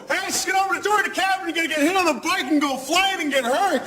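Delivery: rushed warning yell. Retold or imitated. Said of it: "I couldn't go".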